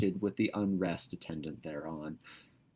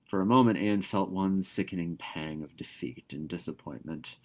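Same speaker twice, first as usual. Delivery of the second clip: ordinary delivery, nothing marked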